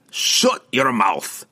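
slow, harsh dwarf snap; harsh and severe. A narrator's reading. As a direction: bark it slowly, harsh. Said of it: "Bruner snapped at him".

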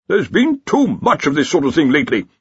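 snapping. Acted. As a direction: snap it out, fed up. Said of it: "snapped the Dean".

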